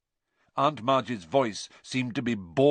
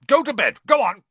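very high, loud orders; furious uncle shouting; shouting Uncle Vernon; 11 dB louder than usual. A narrator's reading. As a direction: drive the boy out of the room. Vernon orders Harry away.

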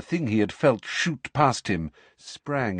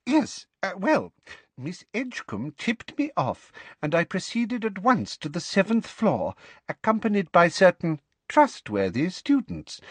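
Umbridge voice, regaining composure; 8 semitones higher, 3 dB louder than usual. Acted. Umbridge explaining to Fudge.

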